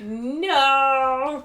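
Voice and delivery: long, drawn-out mock wail of dismay. Retelling the letdown of a trophy popping, mock dismay. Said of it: "My face just dropped".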